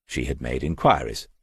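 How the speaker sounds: ordinary delivery, nothing marked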